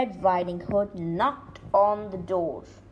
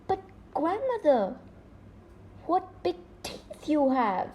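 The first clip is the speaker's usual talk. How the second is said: put-on quivering girl; 6 semitones higher than usual. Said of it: "her voice quivering slightly".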